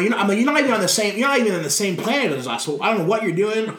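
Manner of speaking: high, fast exasperated scolding; berating a tag partner who is lost; mock frustrated rant. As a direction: rattle it off fed up with him.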